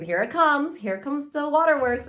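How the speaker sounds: half-joking alarm as the sad line nears; bracing for tears. Retold or imitated. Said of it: "I'm like".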